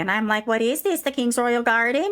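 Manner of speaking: ranting Karen. Acted. Karen ranting to Clara about topiaries, indignant. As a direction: huff about the neighbour. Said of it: "you hear me saying".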